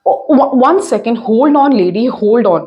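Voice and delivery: loud stage phone voice; brisk phone answering. Acted. Mrs. Mehra taking a call and stopping the caller, brisk. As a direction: answer briskly, then stammer and cut in.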